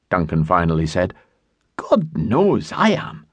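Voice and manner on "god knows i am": slow, tired admission; weary. A narrator's reading. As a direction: let the exhaustion drag the words out. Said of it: "Alex was weary to death of it".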